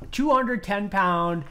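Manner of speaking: ordinary talk, nothing marked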